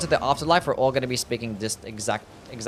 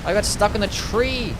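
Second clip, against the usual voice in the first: frustrated outburst; 9 semitones higher, 5 dB louder than usual. Real, not acted. Boss keeps repeating attack.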